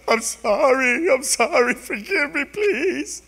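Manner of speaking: high, loud, hammed-up frightened pleading; quivering apology. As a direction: cower and beg forgiveness in a mock-panicked high voice. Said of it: "Makes us start quivering like".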